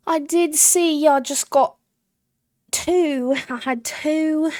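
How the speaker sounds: high, loud hammed-up British accent. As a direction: draw it out loudly in exaggerated British. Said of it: "my British accent".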